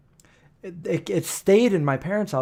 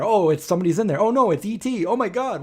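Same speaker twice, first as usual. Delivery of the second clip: higher-pitched startled passerby, lightly acted; mock alarm; 6 semitones higher, faster than usual. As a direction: imitate someone spotting a creature, climbing in pitch. Voices passersby startled by the mask.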